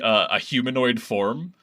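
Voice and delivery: usual speaking voice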